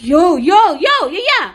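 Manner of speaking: frustrated shout, rising sharply in pitch; exasperated yelling. Acted. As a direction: yell to cut him off. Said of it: "கத்தாதடா".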